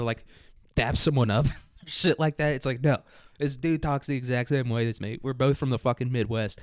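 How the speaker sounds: normal talking voice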